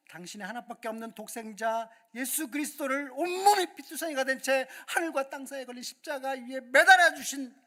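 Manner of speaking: loud, high, impassioned preaching; fervent preaching. Acted. Pastor rousing the youth worship congregation, loud and fervent. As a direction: preach it with everything you have.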